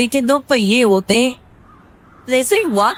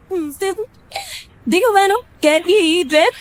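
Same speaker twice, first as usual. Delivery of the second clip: higher, bright show-off of a new dress; young wife showing off; 9 semitones higher, slower than usual. Acted. Wife shows her dress to friends, proudly.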